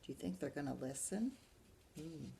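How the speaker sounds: plain reading voice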